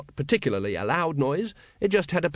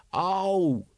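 ordinary delivery

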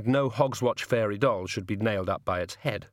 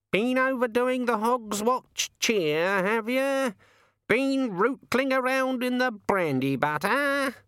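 much higher, slow teasing raven; 13 semitones higher, slower than usual. Acted. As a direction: needle him like a cheeky bird. The raven teases.

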